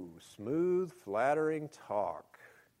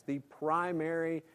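usual speaking voice

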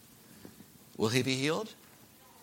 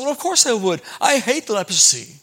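indignant; 5 semitones higher, 6 dB louder than usual. Acted. Angry captain answers servant.

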